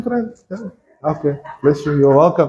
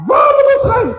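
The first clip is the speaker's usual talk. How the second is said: desperate shout. Acted. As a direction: shout it as a disciple with nowhere else. Peter's desperate plea.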